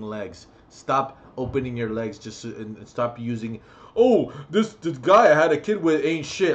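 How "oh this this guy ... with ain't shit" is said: higher, louder mock complaint; mocking mother. Ranting at single mothers, mocking their excuse in higher voice. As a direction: sneer it like a whining mother. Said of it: "I'm like".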